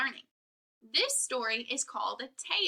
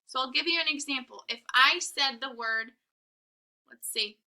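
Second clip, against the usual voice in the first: ordinary delivery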